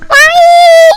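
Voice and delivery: shouted high question; loud Why. Acted. Mock question to viewers.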